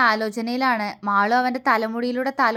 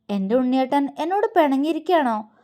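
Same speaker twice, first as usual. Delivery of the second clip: plain reading voice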